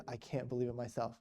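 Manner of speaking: soft, understated astonishment; hushed disbelief. Retold or imitated. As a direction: quietly confide it, as if stunned. Retold stunned priest confides.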